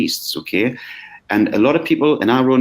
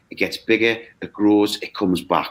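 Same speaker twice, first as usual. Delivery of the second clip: ordinary talk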